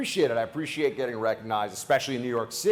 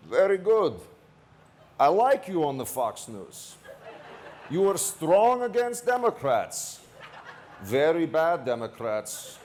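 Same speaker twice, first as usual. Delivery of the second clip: put-on slow, higher Slavic-accented praise from the driver; 3 semitones higher, slower than usual. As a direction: put on a thick Slavic accent, drawn out and earnest. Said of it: "He's got kind of a Slavic accent".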